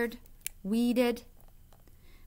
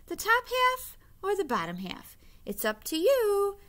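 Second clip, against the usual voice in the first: bright, much higher persuasive voice; eager trickster hare making his pitch; sly Hare; 11 semitones higher, 4 dB louder than usual. A narrator's reading. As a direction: chirp the offer up high like a clever hare. Hare offering Bear a choice.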